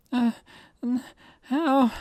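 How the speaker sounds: put-on a girl gasping in shock. Girl reacting to the curse, shocked.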